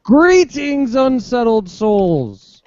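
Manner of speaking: high-pitched greeting, slow and drawn out; hammed-up impression, starting high, stretching the last words; Sam Daganji impression. Shown as a demonstration. Host mimics the arriving co-host's greeting, hammed-up impression. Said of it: "That's my Sam Daganji impression".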